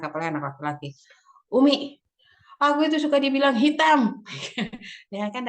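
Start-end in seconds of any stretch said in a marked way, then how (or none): 1.5-4.2 put-on high, loud little boy's complaint; boy telling mum he gets teased; child's voice. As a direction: pitch up like the young son. Son complains to mother.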